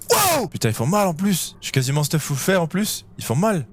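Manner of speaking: high yelp while getting hit; scared yelp. Real, not acted. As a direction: yelp in pain and fright. Attacked by monsters.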